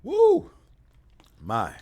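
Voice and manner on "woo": high, loud falsetto woo; excited whoop. Real, not acted. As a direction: let out a big excited woo. Hyped by the trade moves.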